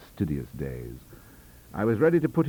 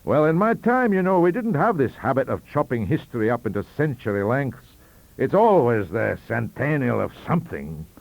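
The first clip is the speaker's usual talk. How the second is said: put-on high, hearty old statesman, raised voice; Sir John's voice; 5 semitones higher, 5 dB louder than usual. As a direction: play the jovial old Prime Minister calling for dessert. Sir John lectures genially.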